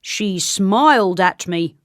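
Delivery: slow, put-on accusing voice; slow official soldier. A narrator's reading. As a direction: lay out the grave charge slowly. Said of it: "said the soldier".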